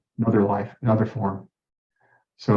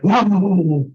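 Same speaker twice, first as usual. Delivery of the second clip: higher, louder drawn-out wow; awed wow; 7 semitones higher, 6 dB louder than usual. Retold or imitated. Retelling the moment the scan reached his heart, awed wow. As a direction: let out a big, sweeping wow.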